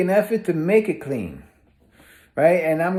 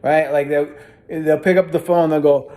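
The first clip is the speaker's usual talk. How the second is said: ordinary delivery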